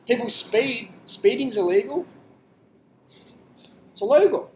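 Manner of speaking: high and loud, mocking the rule; hammed-up indignation, high and loud; mock outrage. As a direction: sneer it like a scandalised scold. Mocking speeding rules.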